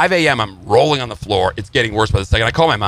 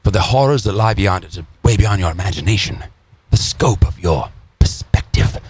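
ominous horror film narration; horror movie narrator; 4 semitones lower than usual. Acted. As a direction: sink into a menacing horror trailer murmur. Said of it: "Like a horror movie?".

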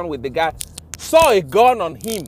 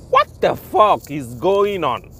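ordinary delivery

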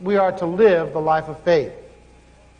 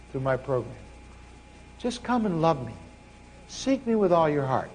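lower, much softer, gentle; voice of God; 4 semitones lower, 8 dB quieter than usual. Retold or imitated. God gently answers the struggling preacher, low soft reassuring voice. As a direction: speak like God calmly reassuring.

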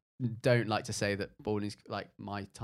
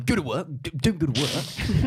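Boris Johnson impression; 6 semitones higher, 8 dB louder than usual. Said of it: "That was a brilliant Boris Johnson impression".

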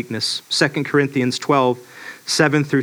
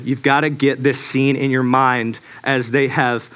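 ordinary talk

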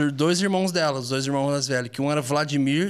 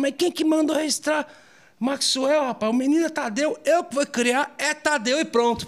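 higher-pitched scolding of an angry mom; retold mom's indignant scolding, pitched up; angry mother; 11 semitones higher than usual. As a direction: mimic a mother fuming at the registry mix-up. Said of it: "minha mãe ficou tão brava".

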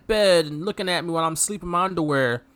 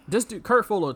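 ordinary talk

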